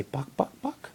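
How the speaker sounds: mock chicken cluck for a toddler; playful buck buck for the baby. Shown as a demonstration. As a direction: cluck it out like a hen. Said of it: "They say".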